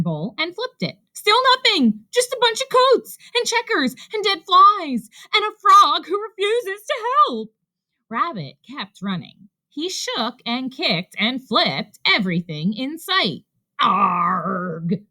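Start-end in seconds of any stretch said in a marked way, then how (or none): 1.1-7.5 high, loud, frantic outburst; frustrated rant. A narrator's reading. Rabbit complaining of coats and an unhelpful frog, ranting. 13.8-15.0 long "arrrgh" of exasperation; frustrated growl. A narrator's reading. Rabbit finding nothing in the search, growling.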